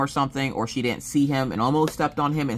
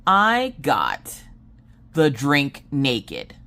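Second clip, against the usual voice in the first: slow explaining; much slower than usual. Retold or imitated. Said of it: "And I was like".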